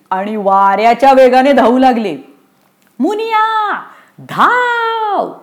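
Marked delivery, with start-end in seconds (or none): 3.0-5.3 very high, long-held call; desperate shout. Acted. Damu calls to Muniya to run, very high drawn-out cry. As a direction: stretch the last word out high, as if calling across distance. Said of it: "Damu ओरडला".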